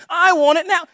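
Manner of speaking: loud, high, whiny bratty demand; Veruca Salt. Acted. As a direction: mimic a spoiled rich girl, British. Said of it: "She had a little British accent".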